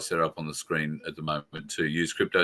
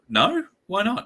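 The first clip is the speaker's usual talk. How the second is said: mock outrage; 13 semitones higher, 3 dB louder than usual. Mock indignant protest over donkey token.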